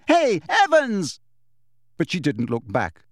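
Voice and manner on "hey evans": loud, high, drawn-out call; shout. A narrator's reading. James calling Lily back, insistent shout. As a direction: holler after someone walking away. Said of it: "James shouted after her".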